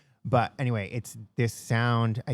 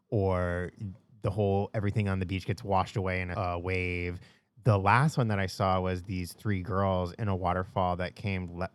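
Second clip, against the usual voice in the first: ordinary talk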